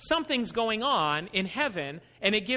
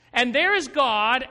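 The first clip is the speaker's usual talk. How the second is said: normal talking voice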